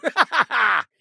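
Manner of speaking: bright burst of laughter; a quick high laugh; short laugh. Acted. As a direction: laugh out at the joke. Laughing at a jab.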